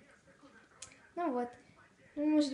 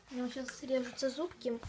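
ordinary talk

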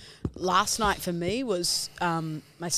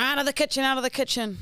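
mock Italian nonno shooing kids, raised pitch; Italian accent; 8 semitones higher, faster than usual. Grandfather shooing kids from kitchen, mock Italian accent. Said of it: "in his Italian accent".

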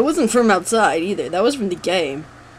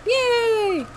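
squeaky, drawn-out little cheer; high, held playful hooray; high-pitched cheer. Pretend. Mock cheer at moving on.

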